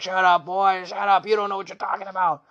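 mock drunken rage at the boy; drunk dad raging. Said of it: "he's raging at the kid".

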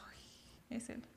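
susurro sin voz, de pánico callado; whispered dread. Retold or imitated. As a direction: dilo casi sin aire, como quien no quiere ser oída. Susurra al reconocer al chico. (susurro asustado)

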